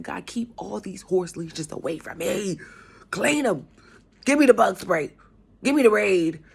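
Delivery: begging God to keep them away, rising to a cry; frantic pleading prayer. Retold or imitated. Praying to God to keep users away, frantic loud plea.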